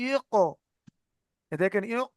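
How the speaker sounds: "ইক" cut short with a jolt, then released; jolted sakin stop. Shown as a demonstration. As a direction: catch "ইক" sharply at the back of the throat. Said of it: "ঝাটকাকে, ঝাটকা হবে".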